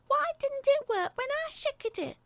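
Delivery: put-on high, loud, stroppy little girl; a sulky child demanding an answer; loud, high whiny kid voice. A narrator's reading. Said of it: "she said stroppily".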